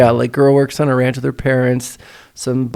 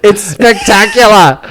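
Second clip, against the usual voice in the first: mock Australian accent; 6 semitones higher than usual. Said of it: "I brought this accent".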